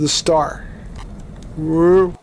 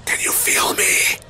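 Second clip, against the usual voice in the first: eerie whisper, breathy and close; ghostly whisper; 3 dB quieter than usual. Pretend. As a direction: whisper it like a ghost haunting someone. Spooking the scientist as a ghost.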